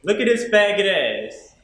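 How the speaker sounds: high, loud mocking classroom yell; imitated yell. Classmate jeering at him in front of the class, imitated yell. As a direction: yell it out slowly like a jeering peer. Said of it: "one of my peers yells out".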